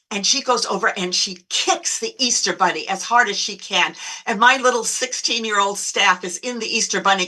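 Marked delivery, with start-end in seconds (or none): none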